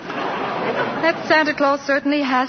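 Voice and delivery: says it half-laughing, a touch slow; amused laugh. Acted. Doris laughing to Shellhammer about the new Santa. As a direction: chuckle through the line.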